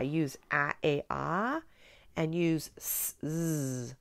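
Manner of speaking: "ah" drawn out, "z" soft and buzzed; clarifying sounds. Shown as a demonstration. Teacher clarifying phonograms to student, careful sounds. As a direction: mark each sound distinctly. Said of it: "go back and clarify".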